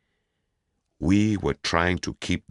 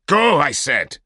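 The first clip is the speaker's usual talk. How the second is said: much louder, higher, furious push; angry order; 12 semitones higher, 9 dB louder than usual. Acted. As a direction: snap at her to go. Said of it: "Don't scream".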